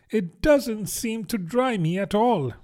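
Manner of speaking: high-pitched mournful complaint; melancholy. A narrator's reading. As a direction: lament it in a high voice. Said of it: "in a melancholy tone".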